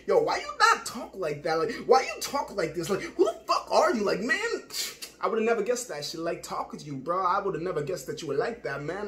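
hammed-up, incredulous challenge, loud and high. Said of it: "types that people put down like".

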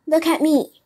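high, forceful plea; emphatic appeal. A narrator's reading. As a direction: call attention to yourself as a warning. Fox offers himself as warning.

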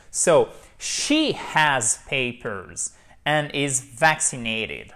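slow, with "papers" and "vaccinated" stressed on the first syllable. Shown as a demonstration. Teacher modelling stress for French students, clapping the beat. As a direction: lean on the first syllable of each word. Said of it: "accent tonique sur la première syllabe".

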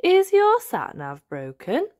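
a lightly voiced grandad asking; slow, gentle old grandad question; Grandad Dog voice. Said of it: "says Grandad Dog".

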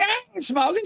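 very high-pitched mock protest; indignant denial. Grandchild accused of smoking by grandmother, indignant denial. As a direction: act out a high, defensive denial.

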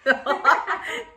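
high cackling laugh like a witch; witch cackle. Real, not acted. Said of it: "Did I just sound like a witch there?".

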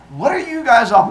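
loud, high, exasperated question; Athena. Acted. Athena asking the pointing crowd, loudly exasperated. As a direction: demand an answer from the crowd. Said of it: "And Athena was like".

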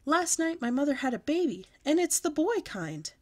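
can't hold the news in; very excited. A narrator's reading. Girl bursts out her big news to the class. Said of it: "I said, very excited".

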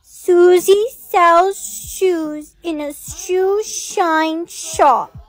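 careful, drawn-out tongue twister; very slow. Acted. Scared little sister reading a tongue twister, very slow. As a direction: stretch each word so none slips. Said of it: "you need to say it very slowly".

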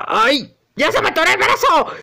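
fast, high-pitched panic; alarmed yelp. Acted. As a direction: squeal that you are stuck. Arm gets stuck.

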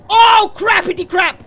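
high, loud cry of dismay; alarmed shout. Real, not acted. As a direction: yell out as things go wrong. Alarmed in game.

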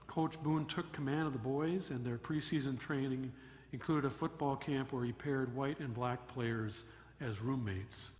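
usual speaking voice

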